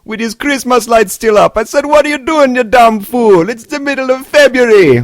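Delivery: loud, high mock-Jamaican rant; scolding a fool in a hammed-up Jamaican accent. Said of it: "he started into a Jamaican accent".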